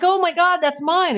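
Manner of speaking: high, loud happy gasp of recognition; retelling a thrilled discovery to her housekeeper; excited exclaim. Retold or imitated. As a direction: claim the money with delight. Retold grabbing found money.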